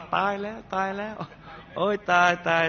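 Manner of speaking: เลียนเสียงเพื่อนบ้านร้องลั่นด้วยความตกใจ เสียงสูงขึ้น; ร้องโอดโอยแบบตื่นตระหนกครึ่งเล่น; เพื่อนบ้านร้องตกใจ. Retold or imitated. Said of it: "ร้องลั่นว่า". (เพื่อนบ้านได้ยินเสียงปืนแล้วร้องตกใจ เสียงสูงตื่นตระหนก)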